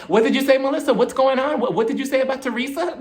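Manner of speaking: high-pitched nosy blurt; mock an indiscreet outburst, laugh creeping in. Jackie asks Melissa aloud, blurting it out. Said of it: "she just opened up her mouth".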